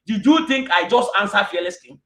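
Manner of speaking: ordinary delivery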